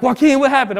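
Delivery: high-pitched, startled question; retold concerned surprise. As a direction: imitate friends alarmed at finding him crying. Soldiers find him crying, retold.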